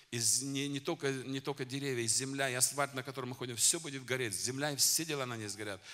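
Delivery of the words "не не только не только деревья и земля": ordinary delivery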